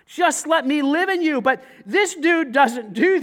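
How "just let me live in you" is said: louder, higher appeal on let; urgent repeat. Acted. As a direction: press the invitation out loud. Same invitation repeated, raised.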